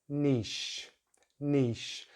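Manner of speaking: "niche" as neesh, sh ending; "niche" with long ee and full sh; British pronunciation. Shown as a demonstration. Teacher stresses end sound, "niche" ending in sh. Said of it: "don't forget that sh sound".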